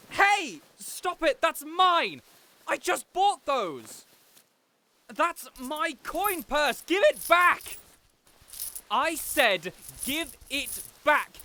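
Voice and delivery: a spoiled noble shrieking at a robber; indignant yelling. Acted. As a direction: yell at the thief to give it back. Robbed in the street.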